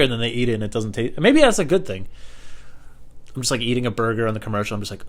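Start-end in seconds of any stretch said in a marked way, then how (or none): none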